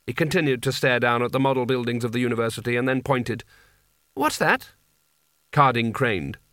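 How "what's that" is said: very high, sudden query; demanding question. A narrator's reading. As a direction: demand an answer, pitched right up. Master points, demanding answer.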